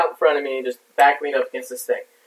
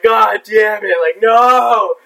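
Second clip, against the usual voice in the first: louder, higher mock shout; 5 semitones higher, 4 dB louder, much slower than usual. Wounded soldier shouting at his bloody pants, mock horror. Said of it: "just like".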